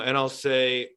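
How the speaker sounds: ordinary talk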